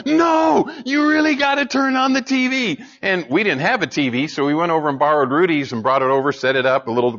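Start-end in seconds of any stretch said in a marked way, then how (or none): 0.1-2.8 urgent retold shout. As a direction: yell it at him like breaking news. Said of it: "She called back five minutes later".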